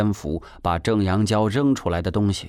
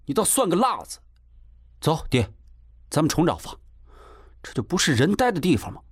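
huffy, higher-pitched indignation; 气呼呼; 7 semitones higher, faster than usual. A narrator's reading. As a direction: fume at him, pitch up, speeding up at the end. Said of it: "贾成气呼呼地说". (气呼呼地提高音调抱怨)

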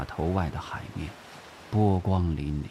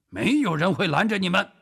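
raised, high-pitched call to a group; loud declaration; 11 semitones higher, 4 dB louder, faster than usual. Acted. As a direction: call it out to all the recruits. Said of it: "继续大声说". (大声宣告，音调高扬)

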